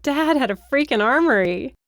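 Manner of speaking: high, loud, amazed exclamation; excited calling out. A narrator's reading. Briar calls companion to see dad's armory, excited. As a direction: call out an astonishing find.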